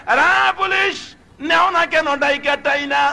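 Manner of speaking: calling out. Acted. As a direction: holler for the policeman, pitched well up. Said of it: "Munsef সাহেব ডাকতেছে".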